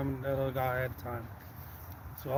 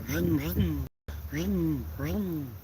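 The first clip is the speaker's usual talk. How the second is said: mimicked engine vroom, higher and louder; engine revving; 3 semitones higher, 3 dB louder, much slower than usual. Retelling how the Camaro revved up, mock engine vroom. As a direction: rev the engine with your mouth.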